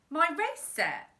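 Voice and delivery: put-on surprised boy.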